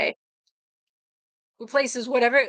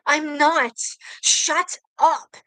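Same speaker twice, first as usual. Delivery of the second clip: angry shout; 5 semitones higher, much slower than usual. Acted. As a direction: yell it, furious and high. Character shouting in fury.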